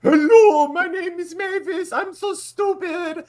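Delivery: put-on very high, loud, slow caricature in a European accent; a European comic mocking a dumb American. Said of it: "a vague European accent".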